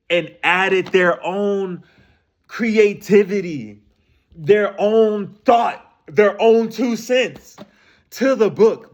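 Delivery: slow, deliberate phrasing; weighty and slow; slow emphatic. Real, not acted. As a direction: lay each phrase down slowly.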